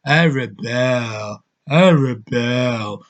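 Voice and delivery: very slow, sleepy, lifeless mock delivery; stoned zombie drone. Mocking the trailer actress again, very slow dead drone. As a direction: drag it out half-asleep. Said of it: "Like they're stoned out of their mind".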